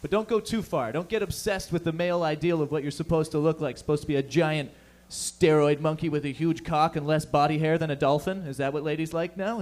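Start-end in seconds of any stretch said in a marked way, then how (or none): none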